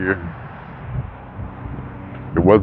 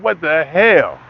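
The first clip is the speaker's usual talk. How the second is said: mock exasperated; 5 semitones higher, much slower than usual. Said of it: "I be going like".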